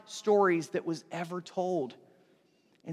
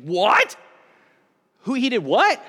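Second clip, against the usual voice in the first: louder, rising disbelieving double-take; startled listener; 4 semitones higher, 7 dB louder, slower than usual. Retold or imitated. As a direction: jolt up in shock at the news. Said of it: "he's like".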